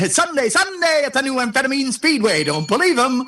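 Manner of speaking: high-pitched mock race-track promoter; speedway announcer. Shown as a demonstration. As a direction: hype it like a speedway radio ad. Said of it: "I understand that one completely".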